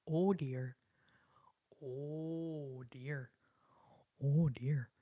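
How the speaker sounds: mock fretting, low and drawn-out; fretting deer. Streamer mimicking a deer freaking out, mock fretting. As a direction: imitate a fretting deer, lightly hammed up. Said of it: "was freaking out".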